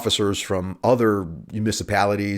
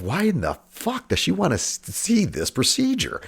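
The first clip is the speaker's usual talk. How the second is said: high, incredulous, slowing at the end; exasperated inner voice; 10 semitones higher than usual. Retold or imitated. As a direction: disbelief pitched way up. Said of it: "I'm just thinking to myself".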